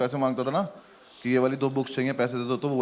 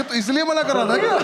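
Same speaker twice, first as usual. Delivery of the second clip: incredulous; 11 semitones higher, 4 dB louder than usual. Retold or imitated. Incredulous question, laughing.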